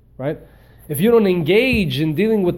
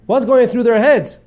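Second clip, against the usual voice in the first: emphatic declaration; 8 semitones higher, 8 dB louder than usual. Real, not acted. Teacher drives home the point.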